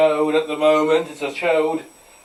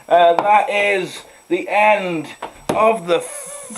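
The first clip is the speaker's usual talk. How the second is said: slow announcer wrap-up; broadcast sign-off; 4 semitones higher, 6 dB louder than usual. Pretend. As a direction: sign off like a TV presenter.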